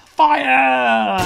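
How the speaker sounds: very high, loud battle cry; shout. A narrator's reading. Connor fires missiles, yelling. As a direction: launch them with a yell.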